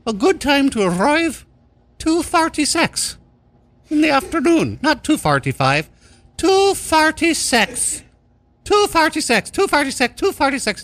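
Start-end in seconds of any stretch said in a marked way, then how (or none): 0.1-4.8 falsetto joke insert; silly high voice. Acted. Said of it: "I'm just gonna add this part myself". 6.4-10.8 falsetto joke insert; silly high voice. Acted. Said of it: "I'm just gonna add this part myself".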